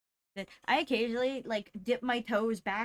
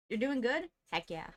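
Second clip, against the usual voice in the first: usual speaking voice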